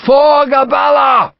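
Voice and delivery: high, loud, drawn-out battle cry; shouted war cry. A narrator's reading. Commander rallying his regiment, shouting the war cry. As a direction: roar it out slowly to rally troops. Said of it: "Gough shouted".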